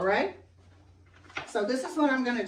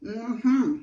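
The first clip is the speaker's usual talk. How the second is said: normal talking voice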